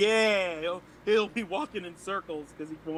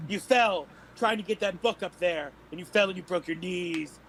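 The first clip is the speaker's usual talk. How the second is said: ordinary delivery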